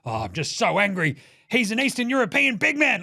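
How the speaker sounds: mock angry Draymond. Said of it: "He's like".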